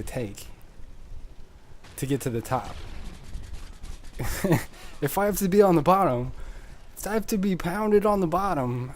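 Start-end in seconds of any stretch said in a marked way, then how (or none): 5.0-8.9 mock fervent. Joking over-the-top declaration.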